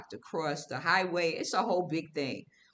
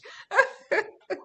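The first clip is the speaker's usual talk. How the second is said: high, louder laugh; flattered laugh; 3 dB louder than usual. Real, not acted. To Dwight after his compliments, bright flattered laugh. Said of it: "You too much".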